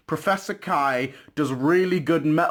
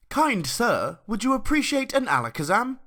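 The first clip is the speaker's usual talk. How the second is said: lightly put-on courteous gentleman, pitched higher; mock-polite offer; 9 semitones higher than usual. Trader luring a victim with a fake offer, mock-polite.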